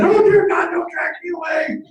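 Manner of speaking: high, loud cry for mercy; hammed-up terror, shouting for help; panicked plea. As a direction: wail it in mock panic. Retells lying terrified in motel bed.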